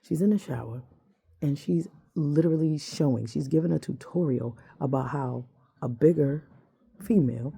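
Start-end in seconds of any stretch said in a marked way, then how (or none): none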